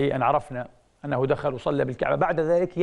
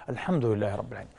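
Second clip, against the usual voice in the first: dying man's praise; 6 semitones lower, 7 dB quieter, faster than usual. Retold or imitated. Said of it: "وهو ينزع يحتضر يقول".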